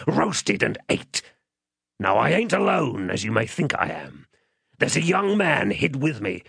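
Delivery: rough captor's fearful threats, rising in pitch on the warnings; menacing man. Acted. Man holding the child upright, menacing. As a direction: lay down terms to terrify the child. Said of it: "went on in these fearful terms".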